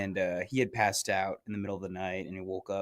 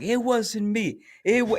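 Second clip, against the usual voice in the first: much higher, louder frantic denial; mock loud denial; 13 semitones higher, 7 dB louder than usual. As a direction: protest your innocence loudly and high. Friend denying loudly.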